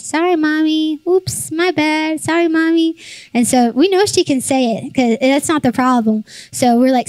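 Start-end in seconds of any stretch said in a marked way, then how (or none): 0.0-3.0 put-on high, sing-song little girl voice; higher-pitched kid mantra, drawn out in the middle; imitated toddler. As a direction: mimic a toddler's breezy little apology. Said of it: "one of her mantras that she says to us".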